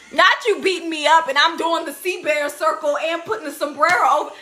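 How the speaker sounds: usual speaking voice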